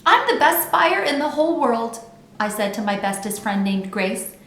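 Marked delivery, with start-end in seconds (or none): 0.1-2.0 loud, high boastful brag; bragging. A narrator's reading. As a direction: show off to a friend. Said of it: "a little bit of bragging".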